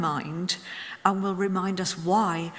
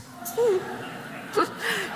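real laugh; 13 semitones higher than usual. Real, not acted. Laughs recalling feathers.

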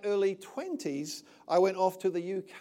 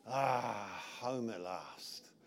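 relieved sigh; 9 semitones lower, 7 dB quieter, much slower than usual. Retold or imitated. Remembered landing home, relieved thought.

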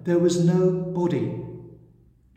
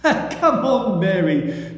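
laughing; 3 semitones higher, 4 dB louder, slower than usual. A narrator's reading. Jesus laughing as Mary hugs him tightly. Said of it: "Jesus laughed".

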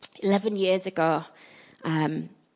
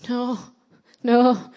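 higher-pitched, effortful little refusal; mustered-up refusal; 5 semitones higher, 6 dB louder than usual. Retold or imitated. Church girl refusing the Nigerian lady, mustering up strength. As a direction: summon all your strength for a hesitant no. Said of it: "I mustered up the strength to say".

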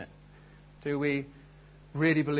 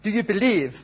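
put-on loud, high go at a French accent; badly faked French showman; half-hearted French accent, pitched up; attempted French accent; 7 semitones higher, 5 dB louder than usual. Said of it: "I can't really do a French accent".

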